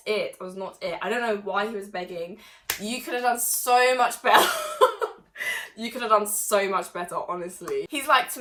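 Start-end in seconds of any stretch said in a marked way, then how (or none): 2.8-5.8 laughing through the words; teasing the ex, laughing. Real, not acted.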